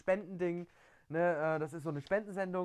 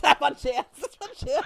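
laughing it off, high and loud; 8 semitones higher, 8 dB louder than usual. Real, not acted. Admitting his joke to his cohost, laughing. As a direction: giggle through the words.

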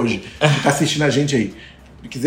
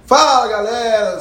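host greeting; 6 semitones higher, much slower than usual. Acted. Show host greets viewers after break.

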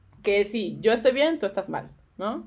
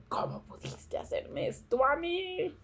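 manipulative victim; 8 semitones higher, 10 dB quieter, slower than usual. Retold or imitated. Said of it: "la juegues así como de".

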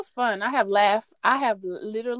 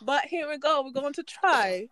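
higher, hammed-up British church announcer; wobbly mock-British accent on a raised pitch; attempted British accent; 6 semitones higher, 4 dB louder than usual. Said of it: "Not really sure what this accent is".

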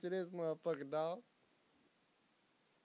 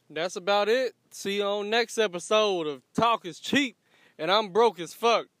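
louder upbeat outro; show sign-off; 4 semitones higher, 12 dB louder than usual. Real, not acted. Host signing off the episode to viewers, hyped outro. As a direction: call out the goodbye to viewers.

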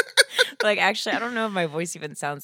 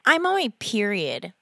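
girl impression; 3 semitones higher, 4 dB louder than usual. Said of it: "This is my impression of a girl".

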